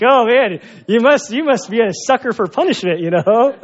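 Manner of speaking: loud, high-pitched mock disbelief; high, punchy playful jab; teasing exclamation. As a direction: exclaim it loudly, ribbing a friend. Joking jab at a latecomer.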